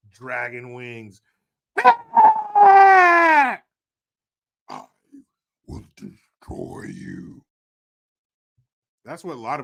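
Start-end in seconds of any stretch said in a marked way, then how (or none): 1.8-3.6 very high shrieking demon cry. Acted. Dragon demon threatening, shrieking high cry. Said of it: "Nancy Dragon demon voice". 5.7-7.4 slow, hissed demon whisper. Acted. Dragon demon threatening, slow menacing whisper. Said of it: "Nancy Dragon demon voice".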